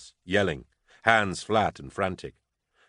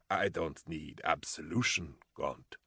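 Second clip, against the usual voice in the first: wet gurgle, much softer; gurgling; 3 semitones lower, 11 dB quieter than usual. A narrator's reading. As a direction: gurgle through blood. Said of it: "Van Voigt gurgled".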